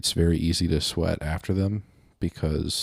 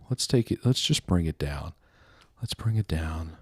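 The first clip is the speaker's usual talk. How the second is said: ordinary talk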